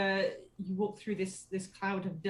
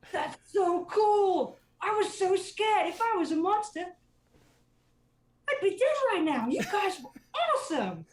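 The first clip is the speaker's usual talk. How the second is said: put-on excited kid; 12 semitones higher, 7 dB louder than usual. Child thanking the adventurers, high and thrilled. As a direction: gush like a starstruck little girl. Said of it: "she's like".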